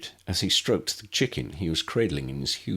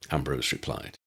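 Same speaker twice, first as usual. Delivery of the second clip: ordinary delivery, nothing marked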